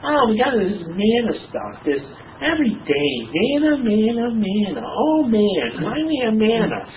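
high, drawn-out mock whining; whiny griping. Israelites sick of eating manna every day, whiny and put-on. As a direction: moan like a fed-up picky eater.

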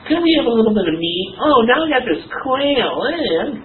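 high, drawn-out mock whining; whiny griping. Israelites sick of eating manna every day, whiny and put-on. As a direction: moan like a fed-up picky eater.

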